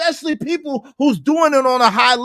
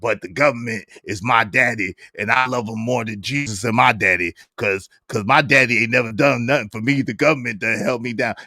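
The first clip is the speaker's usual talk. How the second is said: much deeper mock voice, quickening at the end; mocking impression; 14 semitones lower than usual. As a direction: drone it low and sneering. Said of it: "it would be a good troll".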